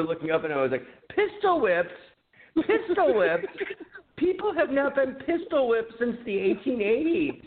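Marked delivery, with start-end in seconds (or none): 1.1-1.9 incredulous. Retold or imitated. Teen scoffing at the cop's claim, high incredulous echo. 2.6-3.5 high rising disbelief, voice thinned; incredulous question. Retold or imitated. Teen mocking the cop's warning, high rising disbelief. 4.2-7.4 high, sarcastic scoff, voice thinned; mocking retort. Teen sassing the police officer, high mocking scorn.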